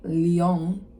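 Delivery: "Leon" as lee-OHN, with a nasal French-style ending; disliked pronunciation of Leon. Shown as a demonstration. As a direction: say "Leon" with a long rounded second syllable. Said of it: "It's not Leon".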